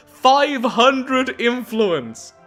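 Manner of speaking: high, loud, drawn-out disbelief; outraged exclamation. Real, not acted. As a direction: stretch each word out in loud outrage. Outraged at the game's price.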